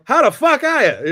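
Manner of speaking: loud, high, hammed-up Boston accent greeting. Walking into a Boston bar, a loud mock Boston accent greeting. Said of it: "do the full Boston accent".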